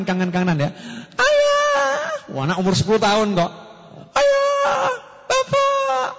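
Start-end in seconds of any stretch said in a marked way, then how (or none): 1.1-2.3 put-on high child's voice calling out happily; child greeting dad with a high, happy call; excited child. Child runs to father home from travel, high happy call. 4.2-6.1 put-on high child's voice calling out happily; child greeting dad with a high, happy call; excited child. Child runs to father home from travel, high happy call.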